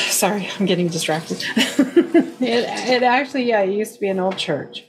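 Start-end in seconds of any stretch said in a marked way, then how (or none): 1.5-2.4 laugh. Real, not acted. Laughing at own distraction.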